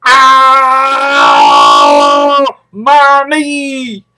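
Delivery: loud high yelp of pain; high loud ow, then my knee; real yelp of pain. Real, not acted. Hurts his knee.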